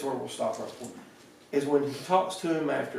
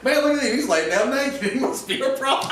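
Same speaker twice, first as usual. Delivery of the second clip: much higher, louder hammed-up amazement; gawking crowd; 10 semitones higher, 5 dB louder than usual. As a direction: play the nosy onlooker. Crowd gawking at naked Saul.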